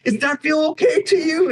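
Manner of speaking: high, tearful question; weeping, buckling guard. Acted. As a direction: fuss over them through acted tears. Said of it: "kinda buckling".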